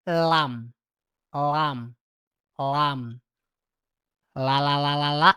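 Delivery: Lam with the tongue curled up; correct lam. Shown as a demonstration. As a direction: clean Lam, tongue on the ridge. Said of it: "seperti itu ya pengucapannya". (pengucapan lam yang benar)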